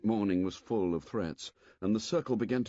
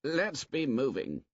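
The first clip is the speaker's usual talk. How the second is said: restless; 6 semitones higher than usual. A narrator's reading. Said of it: "said Jack restlessly".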